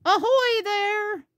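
high, drawn-out boat hail; calling out. Acted. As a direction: sing out a hearty greeting across the water. Grandpa calls from the boat.

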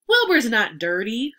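proud. A narrator's reading. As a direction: say it with proud conviction. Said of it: "said Mister Zuckerman proudly".

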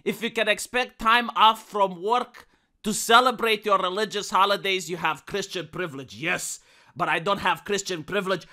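usual speaking voice

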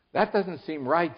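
drawn-out, baffled complaint; helpless. A narrator's reading. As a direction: object with storybook helplessness. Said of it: "said Milo helplessly".

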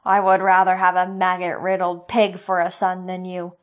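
loud, scornful rage; contemptuous fury. Acted. Sloane refusing his blessing, loud and scornful. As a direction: spit the insult at him.

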